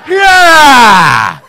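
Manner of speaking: high, very loud karate yell; attack cry. Acted. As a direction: let out a battle cry to attack. Martial arts attack cry in story.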